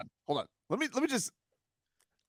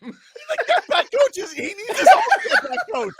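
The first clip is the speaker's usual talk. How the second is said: laughing; 12 semitones higher, 3 dB louder than usual. Real, not acted. Joking about the get-back coach, high and laughing. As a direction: laugh through the words.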